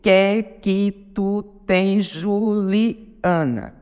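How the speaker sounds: very slow, syllable by syllable; contando sílabas. Shown as a demonstration. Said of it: "conte comigo". (escandindo devagar, sílaba por sílaba)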